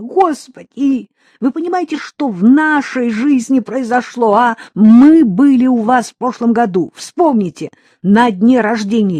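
high and loud, frantic reproach; desperate shout. Acted. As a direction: hurl it at him in anguish. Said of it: "крикнула она".

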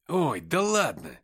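a touch of mock outrage; indignant. Nikita brushing off the driver's jab, mildly indignant. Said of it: "возмутился Никита".

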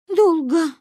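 put-on high-pitched childlike confession; guilty admission. A narrator's reading. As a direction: own up in a small girl's voice. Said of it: "призналась я виновата".

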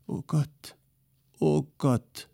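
high, lamenting oh Gott; a despairing wail; mild wailing lament. A narrator's reading. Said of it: "heulte Polixeni".

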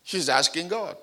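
ordinary talk

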